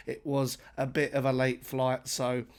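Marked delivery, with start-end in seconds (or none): none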